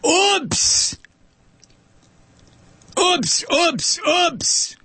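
very high, put-on little voice; mock oopsie. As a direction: squeak it with pretend innocence. Mock regret over ironic payback.

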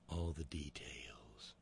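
slow breathy whisper. Retold or imitated. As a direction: lean in and whisper it. Mocking Kavanaugh's lurid interest.

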